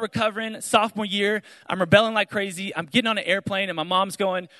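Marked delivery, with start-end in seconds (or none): none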